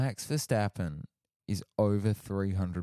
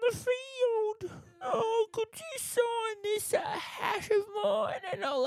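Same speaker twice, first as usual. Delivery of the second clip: very high, tearful starstruck fan; squeaky fan voice. Retold or imitated. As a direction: gush like a weeping superfan. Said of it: "that guy did sound like the first guy".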